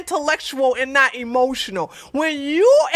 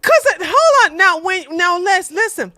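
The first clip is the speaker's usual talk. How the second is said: excited; 7 semitones higher, 4 dB louder than usual. Real, not acted. Breaks off mid-rant.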